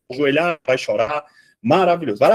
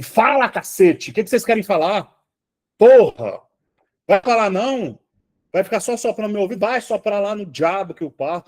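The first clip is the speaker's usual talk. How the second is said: fed-up yell at the spirits; 5 semitones higher than usual. Retold or imitated. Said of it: "Chegou uma hora que eu falei assim".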